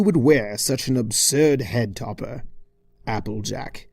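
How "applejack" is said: plain reading voice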